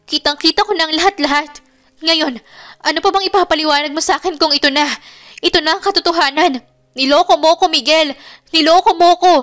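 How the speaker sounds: high, loud, fast raging lament; choked angry outburst. A narrator's reading. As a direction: hold back sobs while accusing him. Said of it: "impit na nagsasalita".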